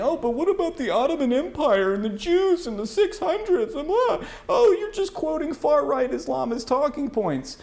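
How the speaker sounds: high, whiny parody of a clueless critic; mocking opponent. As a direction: ham up the critic's whining, breaking into a laugh. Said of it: "And you're saying".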